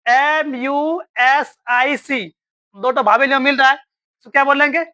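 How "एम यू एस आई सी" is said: each letter spelled out very slowly; slow spelling. Shown as a demonstration. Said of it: "यहीं पर हम लोग mistake करते हैं".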